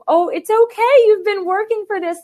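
mom comforting. Retold or imitated. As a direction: play her mom reassuring her daughter. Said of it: "And she's like".

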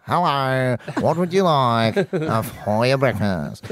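put-on broad Australian accent; nasal Australian salesman voice; Frank Walker impression. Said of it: "a great Frank Walker, awesome Australian accent".

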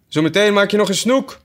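high, bossy sheep voice; fussy sheep. Acted. Sheep warns Alice at the oars, high and bossy. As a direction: scold the rower like a prim old sheep.

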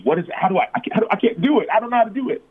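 high-pitched retold helplessness; pleading, at a loss; helpless, overwhelmed. Retelling own helpless struggle.

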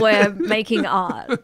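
loud, slow comic declaration; drawn-out mock self-importance; mock-earnest artists. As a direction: proclaim it with put-on artistic pride. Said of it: "they're like".

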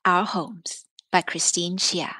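usual speaking voice

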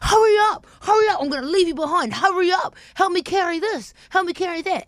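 put-on bossy older brother hurrying his sister along; harsh big brother. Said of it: "you get quite harsh".

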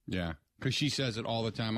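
normal talking voice

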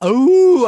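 long, loud, high howl; wolf howl. Acted. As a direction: throw your head back and howl like a wolf. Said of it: "So that was my wolf howl".

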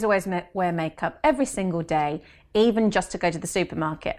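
ordinary talk

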